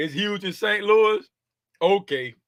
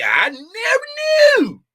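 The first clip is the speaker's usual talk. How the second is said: high, loud, slow mock outcry; mock shocked cry; 6 dB louder, much slower than usual. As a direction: stretch it out in fake shock.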